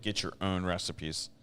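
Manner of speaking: usual speaking voice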